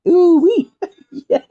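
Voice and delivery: high, loud falsetto ooh-wee into a laugh; a gleeful squeal; excited whoop. Real, not acted. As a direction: whoop with delight then giggle.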